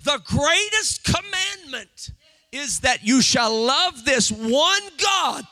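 loud, drawn-out preacher's emphasis; full-force preaching. Real, not acted. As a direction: drive each phrase home to the congregation.

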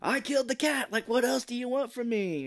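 high, loud angry outburst; an angry customer yelling at the fortune teller; pissed off. Retold or imitated. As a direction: vent at him, fed up. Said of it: "she's pissed".

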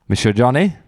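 a playful mock French accent. Host introducing his co-host, in a mock French accent. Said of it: "shouldn't have done a French accent".

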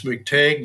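"McTague", rhyming with vague; McTague with long A. Shown as a demonstration. Host offering possible pronunciations of the guest's name, "McTague" with long A. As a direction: say "McTague" with the second syllable like "tayg". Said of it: "correct pronunciation of your name".

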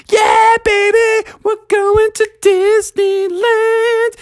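very high, slow shouted cheer; excited cheer. Acted. Everyone squished in the car, high slow excited holler. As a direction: holler it out the window.

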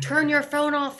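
high squeaky little nag; nagging reminder voice. Pretend. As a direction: hiss it like a pesky reminder in your ear. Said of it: "someone kind of walking alongside you being like".

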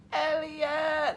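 high, drawn-out emotional cry; hammed-up wail; mock hysterical. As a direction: cry out the name, pitched high. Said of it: "I was hysterical during E T".

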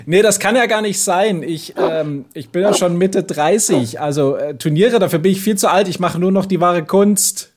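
high, fast innocent pleading; fake innocent. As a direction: talk your way out, too eager. Sketch: lying to police.

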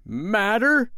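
high, indignant yelled question. A narrator's reading. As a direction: fling the word back in outrage. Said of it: "he yelled".

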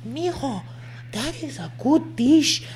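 high-pitched mimic of an older Mexican mother; lightly voiced proud mom; girlfriend's mom gushing about her dish, high mimic. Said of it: "She was like".

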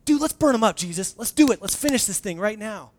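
egging Jesus on, fired up; zealous eager shout. Retold or imitated. Disciples urge Jesus to burn the Samaritans, eager shout. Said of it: "they're like".

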